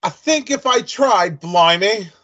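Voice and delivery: high, slow mock English; attempted English accent. Shown as a demonstration. Host tries conversing in the accent and loses it, mock English. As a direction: have a shaky go at English. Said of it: "Nah, I, I lost it".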